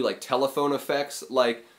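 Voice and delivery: ordinary delivery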